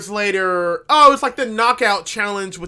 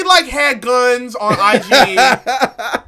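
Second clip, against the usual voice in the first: ordinary talk, nothing marked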